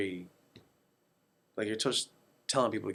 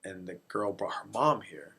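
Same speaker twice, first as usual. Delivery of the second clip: normal talking voice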